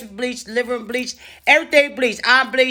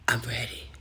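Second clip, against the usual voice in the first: breathless whisper, much lower; 10 semitones lower, 12 dB quieter than usual. Retold or imitated. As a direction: brace yourself in a whisper. Bracing for bad news.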